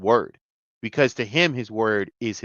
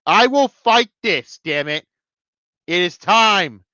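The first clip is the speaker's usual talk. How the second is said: loud, raised, drawn-out battle vow; defiant declaration; 6 semitones higher, 6 dB louder, slower than usual. Real, not acted. Fan vowing to keep fighting this, defiant declaration. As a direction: declare it slowly and loudly, high with resolve.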